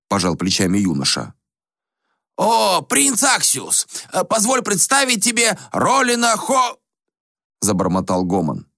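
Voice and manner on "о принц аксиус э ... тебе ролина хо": put-on slow, higher ceremonious old man's voice; a lightly voiced formal old sage; old ascetic making an introduction. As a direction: announce the guest grandly until you're cut off. Gomon introduces, cut off.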